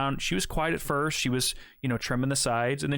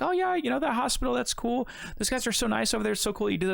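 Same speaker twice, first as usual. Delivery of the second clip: higher-pitched chatty small talk; imitated chatty barber; 7 semitones higher than usual. Barber making small talk about the hospital, chattily imitated. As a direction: mimic a gushing barber.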